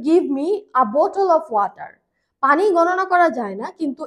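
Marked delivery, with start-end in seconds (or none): 0.1-1.9 slow correct example. Shown as a demonstration. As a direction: Give me a bottle of water read slowly and clearly. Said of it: "যেমন আমি যদি বলি".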